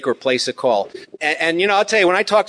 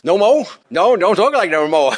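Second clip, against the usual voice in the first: higher, louder mock Louisiana accent; 4 semitones higher, 4 dB louder than usual. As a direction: play a Louisiana ham with his accent. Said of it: "someone from Louisiana".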